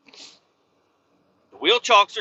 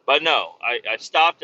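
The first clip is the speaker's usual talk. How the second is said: usual speaking voice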